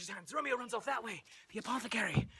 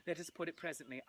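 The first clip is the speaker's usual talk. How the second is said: usual speaking voice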